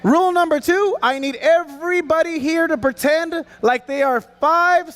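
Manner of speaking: usual speaking voice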